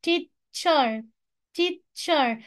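"teacher" broken as tea... cher; split into syllables. Shown as a demonstration. Teacher shows two syllables, "teacher" split in two. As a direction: say "teacher" with a gap between its beats. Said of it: "كل دي كلمات فيها two syllables".

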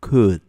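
"Could." said as kud with no L sound; "Could." as kud, L muda; silent L. Shown as a demonstration. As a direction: say "Could." without the L, just kud. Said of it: "no debemos pronunciar la letra L, es muda".

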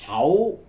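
hậu said as the Cantonese reading. Shown as a demonstration. Teacher showing the Cantonese reading hậu. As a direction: give hậu its Cantonese sound. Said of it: "người Quảng Đông thì đọc là".

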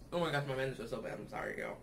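muffled while chewing; talking with mouth full. Real, not acted. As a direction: hand over mouth. Seen 3: Hand covers mouth while eating candy bar; later fingers pick teeth.

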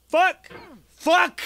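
two high, sharp yells; high frustrated shout; cursing outburst. Real, not acted. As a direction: curse loudly at a near miss. Curses a failed combo.

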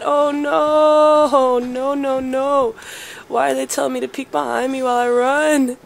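loud, frantic panic while running; panicked. Real, not acted. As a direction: blurt out in alarm. Fleeing while told to look back.

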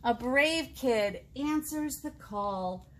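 slow, warm read-aloud of a picture book; storybook reading voice, slow and deliberate; reading to children. A narrator's reading. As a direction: savour each line for the little ones.

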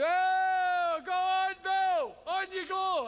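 egging on shout. Retold or imitated. School friends egging him into the cold sea, jeering shout. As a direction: yell it like teasing schoolmates. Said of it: "your so-called school friends, are going".

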